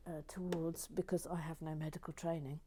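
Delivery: ordinary delivery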